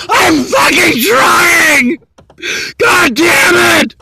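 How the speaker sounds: slow, crying furious shout; screaming and angry. Real, not acted. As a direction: yell it out through real sobs. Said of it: "'cause I'm screaming and angry".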